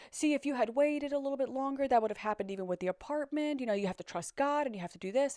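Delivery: a touch of an insistent mother, quick at the end; imitating mom. Mom lecturing her daughter about trusting God, lightly mimicked. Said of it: "mom starts going on about".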